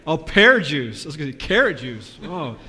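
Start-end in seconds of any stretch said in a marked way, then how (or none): none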